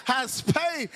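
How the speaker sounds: high-pitched, thrilled astonishment; excited disbelief. Acted. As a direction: react as if you just got a windfall. Acts congregant's thrilled reaction to money.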